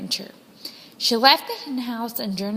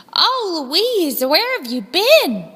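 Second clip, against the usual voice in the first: higher and a touch louder, welcoming her back; relieved exclamation; 7 semitones higher, 4 dB louder, slower than usual. A narrator's reading. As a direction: greet a long-lost friend. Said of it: "She said".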